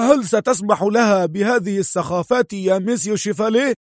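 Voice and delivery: high, put-on indignant cry; feigned anger. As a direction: protest in a high disguised voice. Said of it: "صاح أدهم متظاهرا بالغضب".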